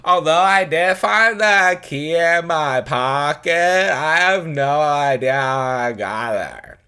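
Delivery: high, loud, slow put-on Fran voice; the little girl snaps back in the Fran voice; Fran-style girl voice. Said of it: "she is going to sound like Fran from The Nanny".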